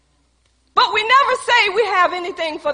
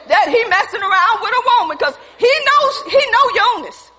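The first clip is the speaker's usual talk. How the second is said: high, raised preacher's voice; full-force preaching; 7 semitones higher, 4 dB louder than usual. Real, not acted. Preacher defending the example to the congregation, full-force preaching. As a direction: press each point out to the whole room.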